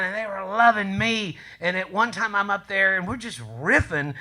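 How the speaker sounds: ordinary talk, nothing marked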